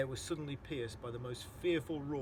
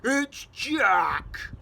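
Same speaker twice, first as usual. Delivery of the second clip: loud high terrified bellow; giant's bellow; 9 semitones higher, 15 dB louder than usual. Acted. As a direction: bellow in panic. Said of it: "bellowed the Flesh Lump Eater".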